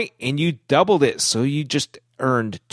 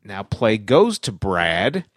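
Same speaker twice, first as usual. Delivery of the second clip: normal talking voice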